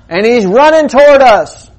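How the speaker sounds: loud, high, urgent yell; panicked shout. Acted. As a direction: keep yelling in alarm. Hiker's shout continues.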